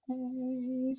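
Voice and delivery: a long yawn escaping through the word; real yawn. Real, not acted. Host yawning on air while waiting for David. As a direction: let the yawn swallow the oh. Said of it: "I feel it coming now".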